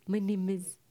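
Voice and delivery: lightly mocked Indian accent; hint of an Indian accent, rhythmic. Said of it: "In Indian, it's like".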